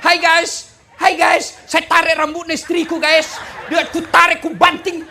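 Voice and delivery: loud, high, laughing livestream greeting; mock vlogger. Preacher mocking a husband on a live video, loud and laughing. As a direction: ham up a husband going live to his followers. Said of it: "Video live, share langsung nih".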